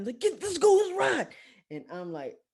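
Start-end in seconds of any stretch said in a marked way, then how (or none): 0.2-1.3 high, loud mock urging; rally them in an agitated yell; upset homeboy. Storyteller imitates homeboy running to chase, upset. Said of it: "he's, like, upset and running".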